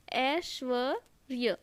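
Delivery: "Aishwarya" slowly as aish-va, pause, rya; syllable by syllable. Shown as a demonstration. Teacher spelling it for students, slow and segmented. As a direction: break "Aishwarya" into parts. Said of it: "ऐसा वाला लगता है".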